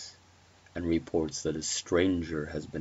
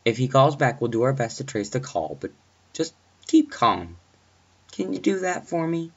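raised, steadying dispatcher voice; reassuring dispatcher; 8 semitones higher, 6 dB louder than usual. Acted. Dispatcher telling the babysitter to keep calm, reassuring and firm. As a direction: keep the caller steady and on task.

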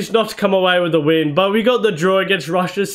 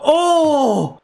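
long, high groan of dismay; 8 semitones higher than usual. Real, not acted. Gamer to himself after a missed shot, dismayed groan. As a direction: let out a drawn-out "oh" at the miss. Missed chance in football game.

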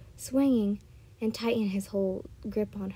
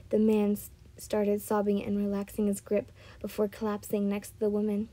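plain reading voice

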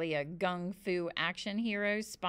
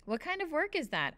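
lightly voiced little girl, higher pitch; curious little girl; 8 semitones higher than usual. A narrator's reading. Annabelle asks Calvin about the hanging, lightly curious. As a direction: lift it into a girl's question.

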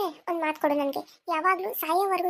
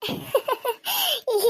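mocking laugh; 6 semitones higher than usual. Laughs at his wife remark.

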